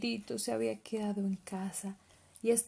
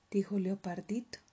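usual speaking voice

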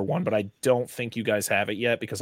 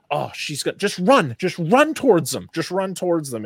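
urgent yell; 7 semitones higher, 5 dB louder than usual. Retold or imitated. Yelling at a movie character.

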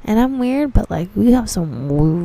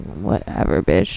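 much lower, dropped-voice brush-off; dismissive; 12 semitones lower, 5 dB quieter than usual. Retold or imitated. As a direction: toss it off low, unimpressed by the customer. Dismissing a pretentious customer.

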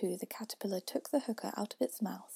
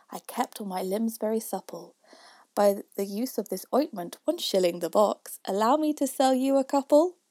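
louder, rhythmic verse; poem recitation; 7 dB louder than usual. A narrator's reading. Youth questions Father William, in loud recitation. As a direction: recite it out with a firm beat.

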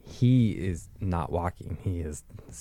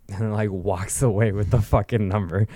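ordinary delivery, nothing marked

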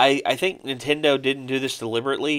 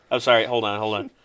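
normal talking voice

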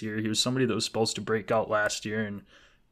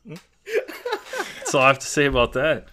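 slow, loud words through laughter; laughing through it; 4 semitones higher, 5 dB louder, slower than usual. Real, not acted. Guest finishing his joke while still laughing.

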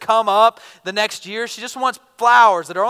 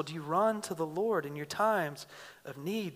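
normal talking voice

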